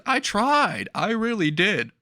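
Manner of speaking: high-pitched, protesting plea; hammed-up desperate excuse; mock pleading. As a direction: plead your innocence in a high voice. All Might pleads in his defence.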